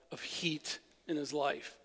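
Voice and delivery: hushed, breathy aside to the congregation; whisper. Acted. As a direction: drop to a whisper on the phrase. Preacher drops voice mid-sentence.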